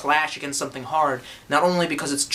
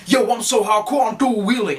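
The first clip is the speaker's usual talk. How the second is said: higher, louder brag; hammed-up bro boasting; mocking tough guy; 6 semitones higher, 4 dB louder than usual. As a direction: act out a posturing poser. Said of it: "people going".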